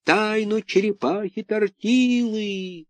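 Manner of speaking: high, slow eerie wail; eldritch horror. A narrator's reading. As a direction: wail it like a ghost. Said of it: "Голос ответил".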